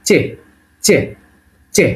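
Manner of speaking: "চে" starting like Bengali চ; "চে" with a crisp চ sound; Russian letter demo. Shown as a demonstration. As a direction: say "চে" short as a model letter name. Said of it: "চ এর মতো".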